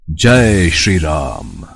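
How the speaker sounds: very slow, grand and resonant; divine proclamation. Acted. Hanuman greets Lord Ram on his reveal, grand proclamation. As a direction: intone it like a revealed god.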